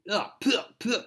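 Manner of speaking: high, sputtering spits as if out of a mouth full of sand; mock spitting. Spider-Man sputtering sand from his mouth, high mock spitting. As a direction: sputter like you're spitting out grit.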